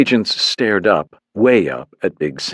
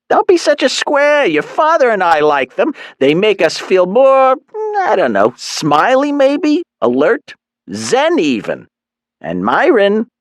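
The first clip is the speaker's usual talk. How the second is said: Mom voice; 13 semitones higher, 4 dB louder than usual. A narrator's reading. Mom chides son.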